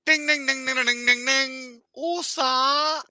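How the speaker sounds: high, loud sing-song ding calls; high loud mocking chime; mock jingle. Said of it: "I went".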